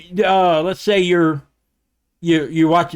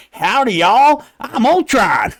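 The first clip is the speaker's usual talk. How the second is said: hammed-up Southern drawl, pitched higher and louder; fake Southern accent; 6 semitones higher, 4 dB louder, much slower than usual. Podcaster imagining a worse Ultron, fake Southern accent. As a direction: put on a mock Southern accent, big and folksy. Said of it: "gave him a fake Southern accent".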